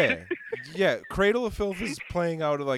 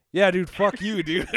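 loud, higher, mock-indignant snap; retold angry retort; 5 semitones higher, 6 dB louder than usual. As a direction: act out a fed-up comeback. Group's angry reply acted out.

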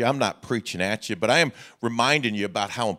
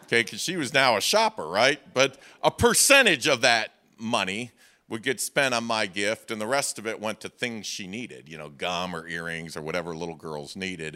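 normal talking voice

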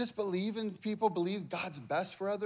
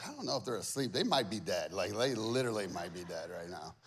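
hushed doubt; 12 semitones lower, 5 dB quieter than usual. Retold or imitated. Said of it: "you're like".

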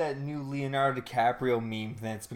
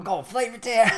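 Guy Fieri-style hype; 9 semitones higher than usual. Retold or imitated. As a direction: shout it like a food show host. Guy Fieri impression shout.

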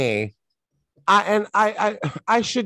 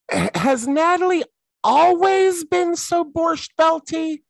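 incredulous; 14 semitones higher, slower than usual. Real, not acted. Exasperated question about character.

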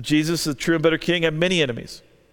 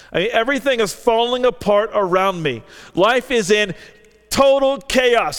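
exasperated; 5 semitones higher, 4 dB louder than usual. Acted. David's life falling apart.

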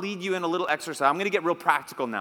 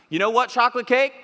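loud, higher sassy call-out; a little attitude; 5 semitones higher, 7 dB louder than usual. Shown as a demonstration. Preacher telling off chocolate cake for the congregation, with attitude. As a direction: confront it with a raised, punchy voice. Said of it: "say it with a little attitude".

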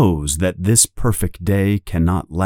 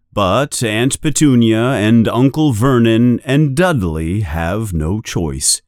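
ordinary delivery

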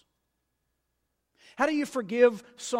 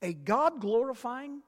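ordinary talk, nothing marked

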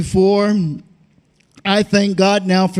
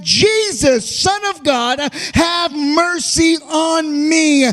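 fervent, raised preaching cadence; preaching at full force; 6 semitones higher than usual. Acted. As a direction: preach it out with a hoarse-free swell. Preacher building fervor.

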